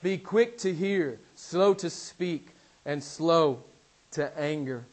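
slow, weighted scripture; measured recitation; slow deliberate reading. Acted. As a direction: let each phrase land. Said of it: "he says".

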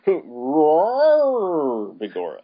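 mock roar into stage-Irish; bad Irish accent. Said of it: "Worst Irish accent ever".

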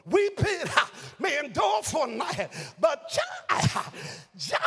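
loud, high, shouted sermon climax; full-force preacher's whoop; preaching at full force. Acted. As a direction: whoop it up like a pulpit closer.

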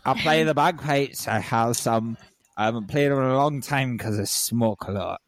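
playful Scottish brogue sliding into Irish; mock Scottish-Irish accent; muddled Scottish brogue. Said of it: "mixing my Scottish brogue with my Irish".

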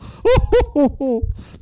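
high, hooting put-on laugh; character laugh. Closing the story reading for the co-host, high character hoot.

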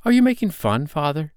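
put-on high, loud child's disbelief; boy's dismayed, high-pitched question; crying out. A narrator's reading. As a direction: demand it in upset disbelief. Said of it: "he cried".

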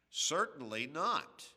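firm emphatic refusal quoted from Paul. Retold or imitated. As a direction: stamp down hard on not. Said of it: "And it was emphatic, with emphasis".

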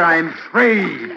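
full-voiced, drawn-out release; firm, forceful exultation; triumphant declaration. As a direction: declare it out over the struggle. Husband triumphs as wife drowns.

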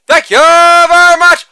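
hammed-up stage thank-you; showman sign-off. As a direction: stretch out the thanks like a showman taking a bow.

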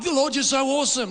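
high shouted praise to the Lord; loud praise. Shown as a demonstration. Preacher showing the church how he praises God, shouting high. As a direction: belt it out in worship. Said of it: "Praising God like this".

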